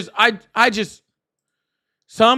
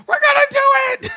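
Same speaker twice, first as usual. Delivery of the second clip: much higher, louder, a burst of hype; excited shout; 4 dB louder, faster than usual. Retold or imitated. Streamer reliving joy at four DJ glasses, excited shout. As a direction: yell it like the news just thrilled you. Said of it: "I just got all excited".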